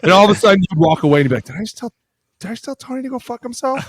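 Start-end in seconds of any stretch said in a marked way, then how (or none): 1.4-3.8 stunned disbelief. Retold or imitated. Player shocked at own outburst.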